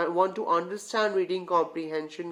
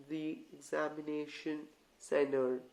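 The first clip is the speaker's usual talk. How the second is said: murmured much softer, as if reading to yourself; quiet reading; 4 semitones lower, 10 dB quieter than usual. Shown as a demonstration. Teacher showing students how to read silently, soft murmur. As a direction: read it under your breath, softly, in an exam hall. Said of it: "But read it like this".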